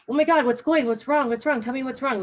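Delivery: loud, high panicked what's wrong; alarmed parent. Retold or imitated. Parent hearing their toddler scream, loud alarmed questions. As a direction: rush in like a frightened parent.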